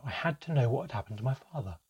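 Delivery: plain reading voice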